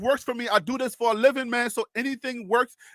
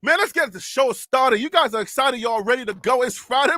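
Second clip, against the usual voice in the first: normal talking voice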